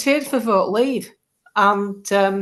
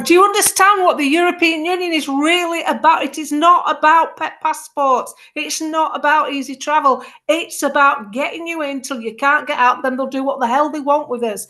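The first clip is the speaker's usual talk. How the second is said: Billy Graham preaching; 6 semitones higher than usual. Retold or imitated. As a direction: preach at the crowd from a bench like a fired-up evangelist. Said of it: "I was like Billy Graham".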